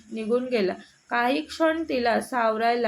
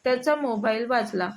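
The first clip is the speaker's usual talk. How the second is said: plain reading voice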